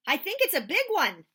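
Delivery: high, loud excited shout; boy shouting about his catch. Acted. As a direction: call it across the water. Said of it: "I shouted".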